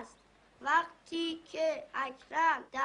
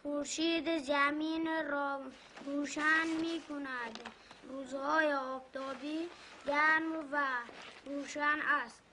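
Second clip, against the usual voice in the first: schoolbook reading; 4 dB quieter than usual. Acted. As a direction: sound out the primer line by line. Boy reads lesson aloud.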